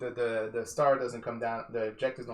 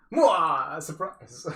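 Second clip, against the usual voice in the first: mock surprise; 6 semitones higher, 8 dB louder, much slower than usual. Real, not acted. Gloats about reserve units.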